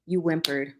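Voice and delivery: ordinary delivery, nothing marked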